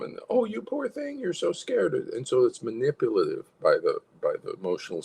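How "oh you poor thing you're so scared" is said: high, cooing pity; protective sympathy. Acted. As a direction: coo over a frightened child, acted. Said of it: "and that draws protection".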